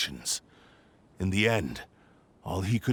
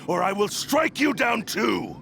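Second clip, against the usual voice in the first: much higher, fierce threat; threatening cry; 12 semitones higher, 3 dB louder than usual. Acted. Sword threatening anyone in its way, fierce cry. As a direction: hurl the threat as you strike.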